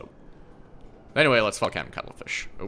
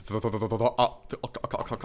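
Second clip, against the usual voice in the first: ordinary talk, nothing marked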